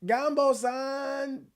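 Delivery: "Gumbo san!" high and slow, still off; high drawn-out call. Shown as a demonstration. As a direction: pull "Gumbo san!" long at a high pitch. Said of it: "I can't do it".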